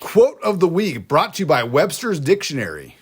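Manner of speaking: mock announcer.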